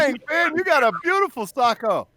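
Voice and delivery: high, loud cooing at a pet. Real, not acted. Cooing at Finn in sock.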